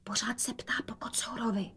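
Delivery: barely a whisper, secretive. A narrator's reading. Rozetka whispers to her mother about the father asking for the cat. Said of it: "dodala šeptem".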